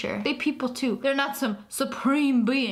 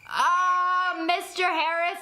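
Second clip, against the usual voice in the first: flustered surprise; 6 semitones higher, slower than usual. Acted. Caught out, awkward.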